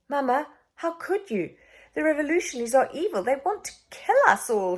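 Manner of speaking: put-on a hurt child accusing his mother; boy's exclamation. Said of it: "he exclaimed".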